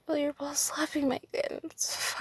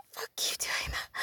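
ordinary delivery

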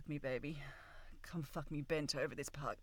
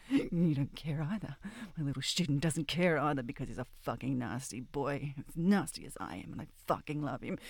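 ordinary delivery

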